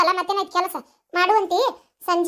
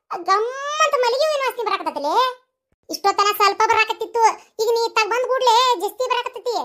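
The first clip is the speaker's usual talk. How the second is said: put-on higher, scolding old mother-in-law; higher-pitched scolding mother-in-law voice; bossy mother-in-law; 6 semitones higher than usual. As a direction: nag her like a village mother-in-law.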